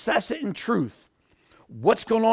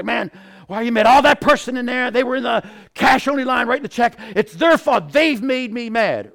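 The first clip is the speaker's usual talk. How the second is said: loud, raised-pitch blaming rant; hammed-up indignation, pushing the blame outward; mad; 5 semitones higher, 5 dB louder than usual. Angry shopper blaming the person in the cash-only line, mad. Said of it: "I am so mad".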